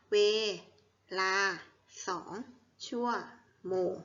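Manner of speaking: measured choral-reading pace; read-along syllables. Shown as a demonstration. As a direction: separate syllables with short, even pauses. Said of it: "อ่านพร้อมๆกันอีกทีค่ะ".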